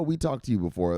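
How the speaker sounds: usual speaking voice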